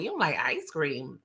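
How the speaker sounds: low, soft, puzzled question; quietly taken aback; low-key disbelief. Acted. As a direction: ask it under your voice, dropping low. First, low take of repeated question.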